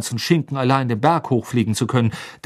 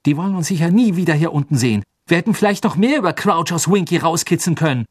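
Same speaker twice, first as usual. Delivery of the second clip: angry; 6 semitones higher, 4 dB louder, faster than usual. A narrator's reading. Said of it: "sagte Ron zornig".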